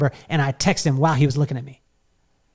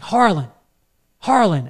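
higher-pitched calling out, not a full shout; retold yell; 4 semitones higher than usual. Big guy summoning his friend, mild retold yell. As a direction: call the name over, falling at the end. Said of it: "Then he yells".

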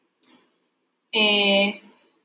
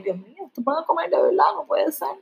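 nervous fright; 5 semitones higher than usual. Retold or imitated. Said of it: "Catalinón asustado y nervioso dice".

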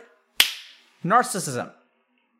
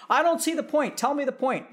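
pushy parent; 9 semitones higher than usual. Acted. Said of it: "acted parent".

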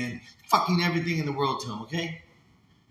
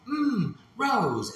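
higher, odd mock-sensual voice; NPC streamer; 7 semitones higher than usual. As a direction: purr the gift name like a TikTok NPC. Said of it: "you go".

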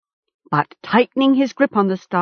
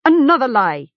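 loud, higher-pitched cry; triumphant accusation; 6 semitones higher, 5 dB louder than usual. A narrator's reading. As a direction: denounce the captive in triumph. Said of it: "the leader cried triumphantly".

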